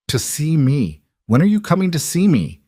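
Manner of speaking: a mother pleading for a visit; insistent mother. Acted. Mother presses daughter to visit.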